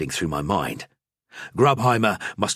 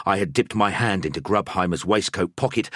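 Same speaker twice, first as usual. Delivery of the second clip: ordinary delivery, nothing marked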